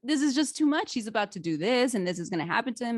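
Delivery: overwhelmed by a tense scene, squealing no; mock frantic protest. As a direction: protest in a rush, like you can't take the suspense. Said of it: "I'm like".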